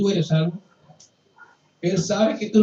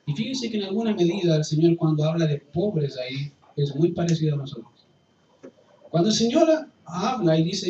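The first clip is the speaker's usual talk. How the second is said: normal talking voice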